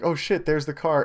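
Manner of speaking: a jolt of surprise at spotting it; startled. Retold or imitated. Spotting the woman's car on his block, startled. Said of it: "and then I'm like".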